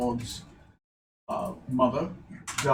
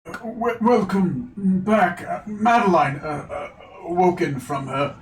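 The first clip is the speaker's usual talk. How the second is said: stammering, higher courtly greeting; halting nobleman; 5 semitones higher, 4 dB louder than usual. Acted. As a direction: stumble through a formal welcome. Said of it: "Charles will address you".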